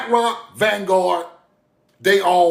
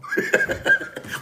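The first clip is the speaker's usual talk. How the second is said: high laugh; 6 dB quieter than usual. Real, not acted. Laughs at own joke.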